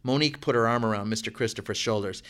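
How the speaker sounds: ordinary delivery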